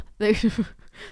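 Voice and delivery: sudden amused laugh; giggle. Real, not acted. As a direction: break into a laugh. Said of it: "made myself laugh there".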